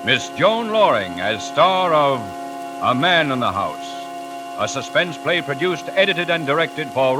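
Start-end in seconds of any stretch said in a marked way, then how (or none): none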